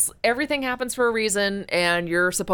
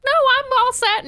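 much higher, louder chirpy refusal; mock brush-off; 5 dB louder than usual. Retelling how she turned down the stranger's help, high loud chirpy refusal. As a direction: chirp it off to wave him away.

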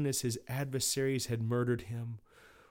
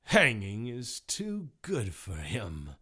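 cruel snarl; slower than usual. Acted. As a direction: snarl it slowly with relish. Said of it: "snarled Mister Cruelty".

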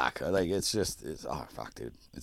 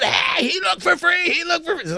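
pitched-up retold holler; mock yelling. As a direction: imitate a pest hollering as he returns. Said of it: "he comes flying up again".

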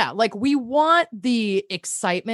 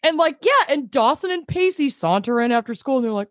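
normal talking voice

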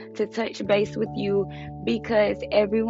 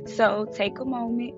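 calm breathing guide, fading softer; guided meditation; 6 dB quieter than usual. Acted. Leads a breathing check-in.

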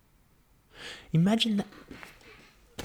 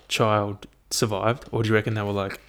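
ordinary delivery

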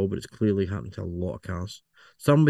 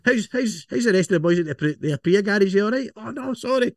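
much higher, nosy cheerful visitor; high woman's voice, chatty; 11 semitones higher than usual. Retold or imitated. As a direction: pitch it way up, chatty, then oops. Mock visitor slipping the name.

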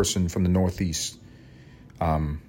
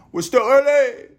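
very high, loud singsong with last word held; silly high-pitched holler; high mock cry; 5 dB louder than usual. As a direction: squeal it playfully and stretch the end. Same silly repeat again.

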